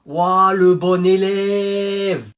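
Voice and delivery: very slow sarcastic ouah; mocking drawl. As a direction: sneer at the teacher's pet. Mocking teacher's pet.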